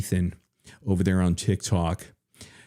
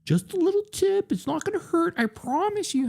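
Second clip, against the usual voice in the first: high falsetto voice; faster than usual. Pretend. Mock reassuring voice, joke.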